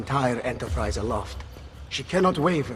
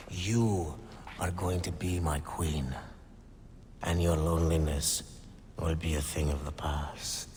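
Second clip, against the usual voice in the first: intimate promise; 6 semitones lower, 7 dB quieter than usual. Acted. Promising lover she'll be queen.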